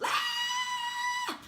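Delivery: sudden high-pitched frustrated scream. Retold or imitated. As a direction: let out a high, overwhelmed shriek. Overwhelmed, venting frustration.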